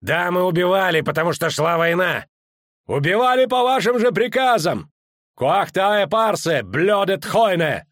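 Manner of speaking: high, loud, slowing defiance; defiant shout. A narrator's reading. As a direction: shout back with pride. Officer defiantly shouting back. (дерзкий крик)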